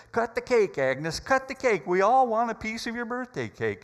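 loud, high, cheering prompt; urging. Retold or imitated. Harry urges Agnes to cut her cake, loud cheering. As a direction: egg her on heartily.

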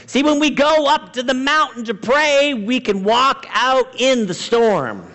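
high-pitched, fervent pulpit delivery; impassioned preaching. Real, not acted. Preacher urging the congregation to pray before the storm, impassioned.

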